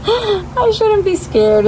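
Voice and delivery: nervous laugh, then a high slow whine; high drawn-out nervous voice; scared. Real, not acted. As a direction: talk yourself out of being scared. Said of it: "I'm scared".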